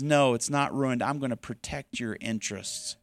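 soothe, don't preach; gentle voice of God comforting; reassuring God voice. Acted. God answering the student.

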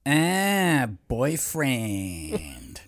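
drawn-out attempt at a South American accent. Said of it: "he had a South American accent".

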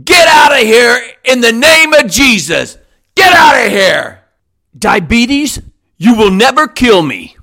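ordinary talk

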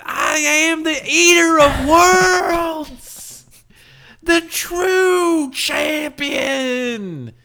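very high, drawn-out, loud swamp cultist; hammed-up wrestler proclamation, every word stretched; Bray Wyatt. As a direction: wail it like a deranged southern preacher. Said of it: "all he did was do a Bray Wyatt impression".

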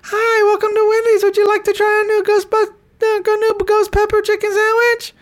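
squeaky drive-thru greeting; drive-thru worker. Retold or imitated. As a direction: chirp it like a cheerful fast-food cashier. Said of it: "She goes".

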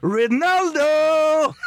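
high, drawn-out Portuguese "Ronaldo!"; Portuguese accent. Pretend. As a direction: belt "Ronaldo!" slowly, accented. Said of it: "do a Portuguese accent".

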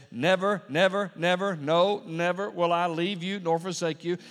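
loud, high run of "never" with no gaps; emphatic repetition. Shown as a demonstration. Preacher repeats the promise like a teacher, forceful repetition. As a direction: drive "Never, never, never, no, never" home loudly in one sweep. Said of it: "So a literal translation is".

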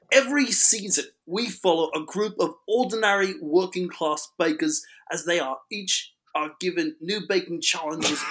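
an amateur's mock British accent, lightly done; hammy British host doing a cheery review in a British accent; attempted British accent. [name] pitching a baking show to podcast listeners, in a playful attempted British accent. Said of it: "I have to do this in an attempted British accent".

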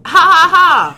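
loud, high mock laugh; exaggerated, put-on ha-ha-ha; acted-out LOL laugh. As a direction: spell out each ha forcefully. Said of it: "You gotta do the sound, though".

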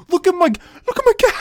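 mock squeal, high and loud; high excited mock voice. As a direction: squeal it out like a kid. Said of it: "interrupting him like".

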